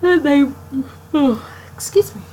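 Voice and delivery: talking through a yawn; words pulled long by a yawn. Real, not acted. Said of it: "Sorry about the yawn".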